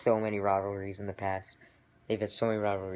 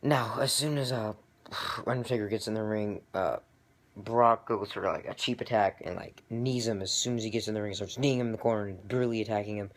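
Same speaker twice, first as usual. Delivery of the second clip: ordinary delivery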